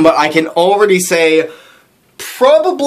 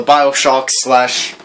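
ordinary delivery

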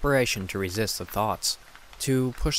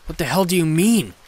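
higher and louder, a sharp retort; angry, louder retort; shot back; 8 semitones higher, 8 dB louder than usual. Acted. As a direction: fire it straight back at him. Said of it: "I shot back".

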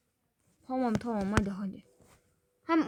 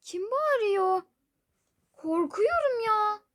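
scared; 7 semitones higher, slower than usual. Real, not acted. Alone, frightened at night.

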